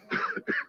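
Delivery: a real dry cough from tear gas. Real, not acted. Reporter coughs after a tear gas hit. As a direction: cough through stinging gas.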